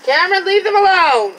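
loud, high sharp telling-off; scolding. Real, not acted. Mom scolding son mid-video.

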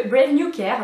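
French-accented English brand name; self-mocked English accent. Real, not acted. Vlogger reads out the next product's brand in self-mocked English accent. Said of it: "avec mon superbe accent".

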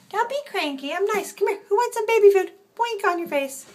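high-pitched coaxing. Real, not acted. Offering kittens baby food, high baby talk. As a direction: coax a fussy pet in a high voice.

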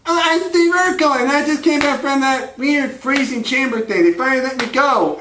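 high nerdy character voice; Steve Urkel. Acted. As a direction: explain yourself as a squeaky sitcom nerd. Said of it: "And Steve Urkel's like".

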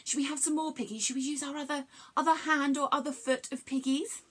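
ordinary talk

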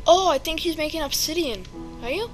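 usual speaking voice

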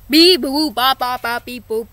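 lightly voiced robot beeping; robot beeps. Pretending to be a robot while hiding, mild beeps. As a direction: beep and boop like a toy robot, fading.

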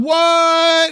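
high, loud, drawn-out disbelief; astonished. Real, not acted. Streamer learns the restaurant closed down, astonished. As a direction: stretch it out high in shock.

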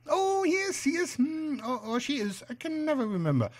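high, musing spider voice; high-pitched old spider. Acted. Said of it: "shrill, high-pitched voice sort of spoils the effect".

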